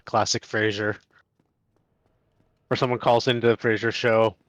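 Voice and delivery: usual speaking voice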